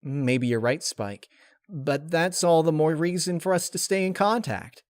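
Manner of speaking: breezy promise to a sad friend; lightly voiced Rainbow Dash.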